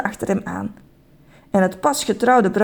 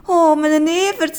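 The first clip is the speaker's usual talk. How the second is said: tender; 7 semitones higher, slower than usual. A narrator's reading. Bride hugs beloved Evert.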